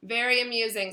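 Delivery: a touch of menace; dark. A narrator's reading. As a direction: say it with dry displeasure. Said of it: "said Nearly Headless Nick darkly".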